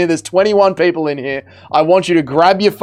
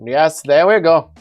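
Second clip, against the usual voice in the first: put-on Indian accent. Streamer playing along with his chat, Indian accent. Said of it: "that is the accent that they have here today".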